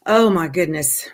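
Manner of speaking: ordinary talk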